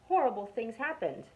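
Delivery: plain reading voice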